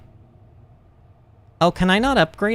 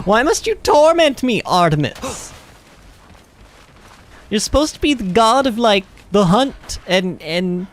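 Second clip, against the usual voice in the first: mock anguish; 4 semitones higher than usual. Mock lament to Artemis.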